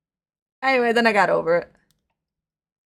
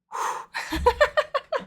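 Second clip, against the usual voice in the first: high giggle. Real, not acted. High laugh after joke.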